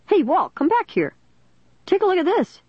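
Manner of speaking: high, excited calling out; surprised cry. A narrator's reading. Policeman calling his partner back, surprised cry. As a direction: call him over in surprise. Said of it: "the other policeman's surprised cry".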